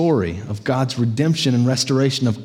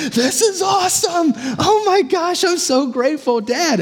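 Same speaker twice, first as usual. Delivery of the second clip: much higher, shouting with delight; excited son. Acted. Optimist son overjoyed at his room, shrieking thanks to dad. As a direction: squeal it out like a thrilled kid. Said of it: "the optimist son is so excited".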